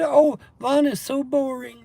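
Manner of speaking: high, slow, whiny sneer; mocking his trolls by whining that he's boring. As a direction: mimic the trolls with a drawn-out whine. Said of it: "that's what the trolls say".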